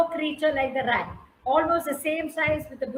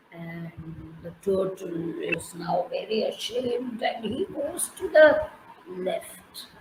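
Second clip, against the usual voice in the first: whisper; 7 semitones lower than usual. A narrator's reading. Storyteller hushes for ashamed turtle.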